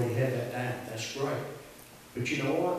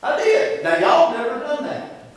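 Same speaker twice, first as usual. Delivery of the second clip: higher, much louder pulpit confession; full-force preaching; 13 semitones higher, 11 dB louder than usual. Real, not acted. Preacher confessing to the congregation, full-force preaching. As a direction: preach it out loud and high.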